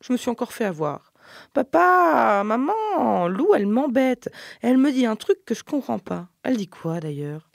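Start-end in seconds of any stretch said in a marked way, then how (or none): 1.5-6.3 high, loud tearful whine; a small girl tattling on her sister, high and tearful; crying complaint. Acted. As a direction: wail to mum and dad like a wronged toddler. Toddler complains to her parents.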